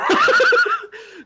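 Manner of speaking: squealing laugh; high burst of laughter. Real, not acted. Laughing at own joke.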